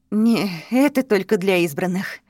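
panting between words; out of breath. Acted. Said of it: "ответил запыхавшийся Дин".

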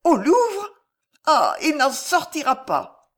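very high cry, then drawn-out dread; alarmed, trembling. A narrator's reading. Alice fears her fiancé will never leave the Louvre, trembling alarm. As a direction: cry out, then lament slowly. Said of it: "s'écria Alice frémissante".

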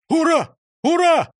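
loud high hurrahs; a rousing loud cheer; bellowing cheer. A narrator's reading. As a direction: shout the hurrahs together. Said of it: "гаркнули все".

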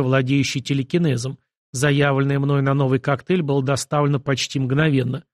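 ordinary delivery, nothing marked

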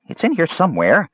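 high-pitched little kender voice; chatty kender. A narrator's reading. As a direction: kender rummaging, high and unbothered.

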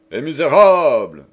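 loud growl of rage. Acted. The delirious king growls at imaginary traitors, loud. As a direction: roar it out at imagined enemies. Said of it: "gronda-t-il".

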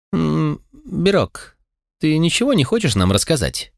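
high, pressing demand to explain. A narrator's reading. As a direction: call him out sharply. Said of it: "Требовательно уставилась". (требовательно)

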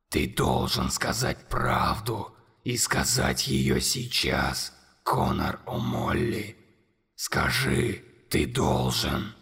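very low, slow, whispered monster voice. A narrator's reading. Monster whispering accusations to Conor, low and slow. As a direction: loom over the boy and breathe every word.

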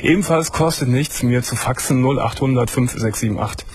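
normal talking voice

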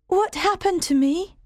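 put-on dazed girl asking; weak question. Jane wakes and asks the doctor, child's voice. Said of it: "I asked him".

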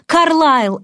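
high, loud cry for help; urgent shout of a name; desperate call. A narrator's reading. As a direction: call out in despair. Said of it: "отчаянно позвал ангел".

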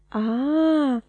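a far, drawn-out ah; cried out. A narrator's reading. Miss Mowcher crying out at the name Emily. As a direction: sing out the ah in surprise. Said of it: "a strigat ea".

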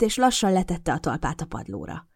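plain reading voice

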